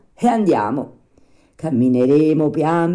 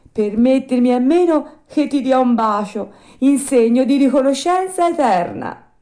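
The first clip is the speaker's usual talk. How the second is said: high, loud voice full of emotion; moved; 11 semitones higher, 7 dB louder than usual. A narrator's reading. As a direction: thank a rescuer with heartfelt, moved intensity. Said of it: "con voce commossa gli disse".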